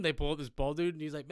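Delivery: ordinary delivery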